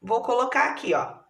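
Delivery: usual speaking voice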